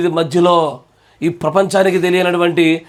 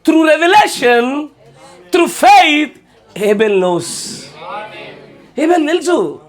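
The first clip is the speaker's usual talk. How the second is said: preaching at full force; 8 semitones higher, slower than usual. Acted. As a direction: proclaim it to the congregation with rising fire.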